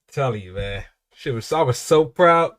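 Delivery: normal talking voice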